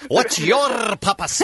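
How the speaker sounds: slow, rolled R on "papas" in "What's your papas?"; a mocking Spanish-style trill on "papas"; mock trilled accent. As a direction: mimic the ad's trilled R, drawn out. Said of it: "Why do you trill your R there".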